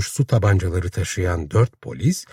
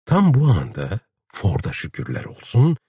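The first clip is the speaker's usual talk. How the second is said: usual speaking voice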